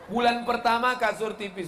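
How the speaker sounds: ordinary talk, nothing marked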